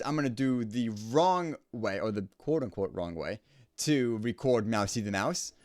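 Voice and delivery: ordinary talk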